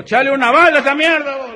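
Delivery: very high, loud rustic voice; rough shouting. Acted. Rigo tells the bartender to add a bullet, very high and loud. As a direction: bark the order with swagger.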